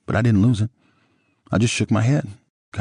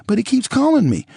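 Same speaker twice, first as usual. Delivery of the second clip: crying; 5 dB louder than usual. A narrator's reading. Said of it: "She was crying again".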